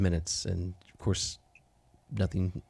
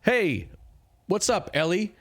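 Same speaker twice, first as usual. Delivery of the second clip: high, louder friendly hail; second try at the Goliath's voice, louder and more hearty; giant's greeting retake; 10 semitones higher, 4 dB louder than usual. Acted. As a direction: call out cheerfully to an old friend. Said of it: "I need an actual voice here".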